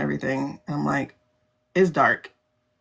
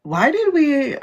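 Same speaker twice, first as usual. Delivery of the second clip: high, louder disbelief; higher exasperated question; 8 semitones higher, 3 dB louder, slower than usual. Retold or imitated. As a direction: burst out in incredulous regret. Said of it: "They're like".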